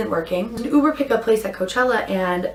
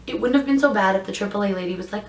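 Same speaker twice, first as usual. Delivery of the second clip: ordinary talk, nothing marked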